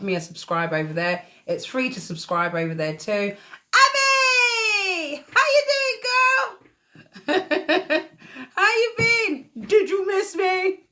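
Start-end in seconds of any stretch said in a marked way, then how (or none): 3.7-10.8 high, loud squeal of delight, laughing through it. Real, not acted. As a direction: greet your long-lost friend with a gleeful shriek. Delighted greeting of a returning viewer.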